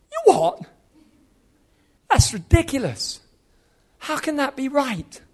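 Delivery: high-pitched, incredulous retort; outraged disbelief. Retold or imitated. Young preacher answering God's startling word, outraged. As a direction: blurt it out in scandalised disbelief. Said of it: "I thought that was outrageous".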